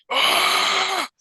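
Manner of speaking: high mock scream of agony; hammed-up agonized scream; retold scream. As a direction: shriek it like a possessed psychic. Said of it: "starts screaming".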